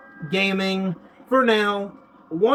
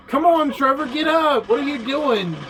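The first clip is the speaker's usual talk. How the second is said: higher-pitched, exasperated urging; pleading with a player character, pitched up; 6 semitones higher than usual. Real, not acted. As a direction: yell at your game character to get moving. Urging game character to get up.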